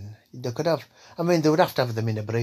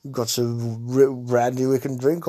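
posh Frank; 7 dB louder than usual. Acted. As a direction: greet them grandly as a plummy toff. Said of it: "old posh fucking Frank here".

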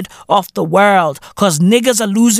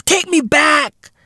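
mock plea; 8 semitones higher than usual. Pleading nostalgia.

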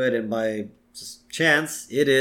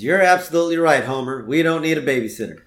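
fast, louder eager agreement; Bart's scheming voice; 4 semitones higher, 6 dB louder, faster than usual. Retold or imitated. Bart egging Homer on, fast and eager. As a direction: rush it out slyly. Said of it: "And Bart says".

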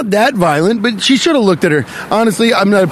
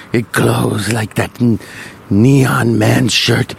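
put-on slow, low German-accented wonder; 7 semitones lower, much slower than usual. Ponce fascinated by a diner's shirt, in a German accent. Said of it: "I don't know why he's German".

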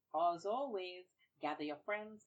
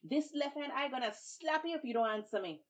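high, loud threat; more vexed; 8 semitones higher, 6 dB louder than usual. Acted. Babuki threatens the tar baby, high and loud. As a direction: threaten her with the slap. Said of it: "he got even more vexed".

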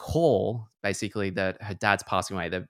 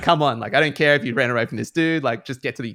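mock impatience; 5 semitones higher, 7 dB louder than usual.

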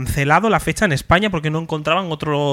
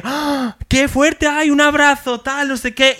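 higher excited gushing; imitating idol's delight; 7 semitones higher, slower than usual. Imitating Trixie excitedly offering a hug, higher pitch. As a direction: gush like a star hugging a fan.

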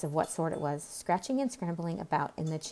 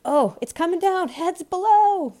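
shouted warning; 12 semitones higher, 10 dB louder than usual. A narrator's reading. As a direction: yell a warning to everyone below. Workers shout warning of falling slate.

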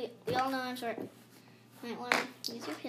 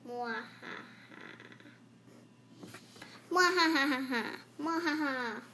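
loud hammed-up villain laugh; evil cackle; 7 dB louder than usual. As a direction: cackle like a cartoon baddie.

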